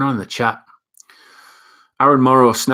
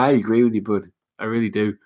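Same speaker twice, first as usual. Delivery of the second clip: usual speaking voice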